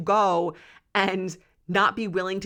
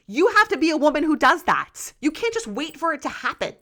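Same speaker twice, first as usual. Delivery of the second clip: raised, impassioned insistence; higher and louder, pressing the point home; fired up; 8 semitones higher, 6 dB louder than usual. Real, not acted. Urging women to show their bodies, super fired up. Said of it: "I'm getting, like, super fired up".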